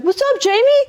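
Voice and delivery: high, bright-eyed mimicked hello; imitated carefree high-pitched greeting; cheery greeting. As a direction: call out to an old schoolmate, upbeat. Voicing old schoolmate's cheerful greeting.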